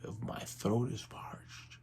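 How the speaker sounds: hushed, breathy whisper of an odd coworker asking a favor. Retold or imitated. Coworker whispers asking to get water from the fridge. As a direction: lean in and whisper the request. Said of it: "And he's like".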